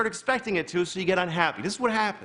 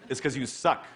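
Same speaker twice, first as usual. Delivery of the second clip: low mock horse; 9 semitones lower than usual. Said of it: "he goes".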